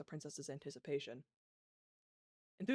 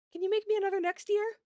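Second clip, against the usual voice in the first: put-on eager child; 10 dB louder than usual. Princess asks eagerly.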